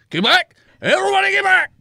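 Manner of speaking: very high, loud panicked shout; shouted warning. Acted. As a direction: yell them back from danger. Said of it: "he very quickly motions everyone".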